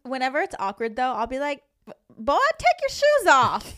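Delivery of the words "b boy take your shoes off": put-on southern accent. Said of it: "you always gotta do, like, a southern accent".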